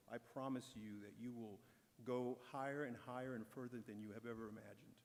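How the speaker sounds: normal talking voice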